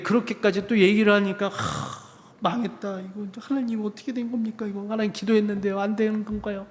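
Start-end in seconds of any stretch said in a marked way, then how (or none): 1.5-6.7 higher, softer, half-whispered despair; a hint of panic, asking God why; panicked inner plea. Before the exam, pleading to God in a soft, high panic. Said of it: "막 이랬어".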